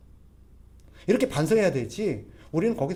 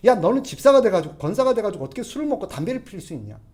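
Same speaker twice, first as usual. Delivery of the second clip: higher, much louder scolding shout; loud rebuke; 5 semitones higher, 7 dB louder than usual. Acted. Churchgoer scolding a deacon who drinks and smokes, loud mock shout. Preacher acts out harsh rebuke.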